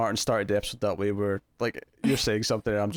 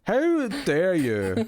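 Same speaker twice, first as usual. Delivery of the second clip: drawn-out, higher mock indignation; mock outrage; 7 semitones higher, much slower than usual. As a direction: play the offended diva, slowly. Mock outrage at emo jab.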